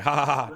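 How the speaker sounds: loud, spelt-out ha-ha-ha; loud imitation of laughing; retold laugh. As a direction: act out a big laugh in a story. Retold laugh at obvious answer.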